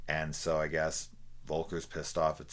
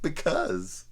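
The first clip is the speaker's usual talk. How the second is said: higher, louder smug one-word answer; smug villain reply; 11 semitones higher, 7 dB louder than usual. Retold or imitated. Villain answering the screaming woman, smug smirking reply. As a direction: say it with a gleeful smirk. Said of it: "He's just like".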